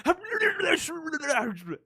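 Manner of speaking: high squealing laugh through the words. Real, not acted. Telling the kid story, laughing in a high squeal.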